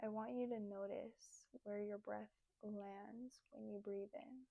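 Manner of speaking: soft, unhurried meditation voice with long pauses; guided meditation. Acted. Leads guided breathing meditation.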